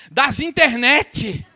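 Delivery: high, loud and slow, drawn out; a villager's proud answer, loud and slow; simple woman's reply. Retold or imitated. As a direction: lean into the folksy grammar, beaming. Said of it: "eu gostei do das internet".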